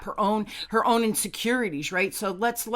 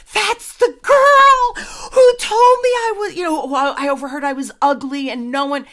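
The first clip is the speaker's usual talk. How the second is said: indignant outburst; 10 semitones higher, 11 dB louder than usual. Retold or imitated. Wife revealing her old bully to her husband, loudly indignant. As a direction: cry it out, re-enacting the indignation.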